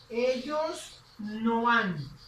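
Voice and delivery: drawn out at x0.3 pace; slow model answer; slow short answer. Shown as a demonstration. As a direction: say the answer slowly and clearly. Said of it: "de forma corta".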